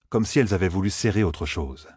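ordinary delivery

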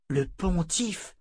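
slow, quiet echo with a rising end; stunned. A narrator's reading. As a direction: repeat it slowly as if dazed. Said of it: "Fitch parut sonner".

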